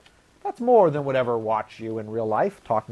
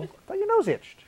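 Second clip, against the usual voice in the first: innocent high voice; 4 dB quieter than usual. Retold or imitated. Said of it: "And I'd go".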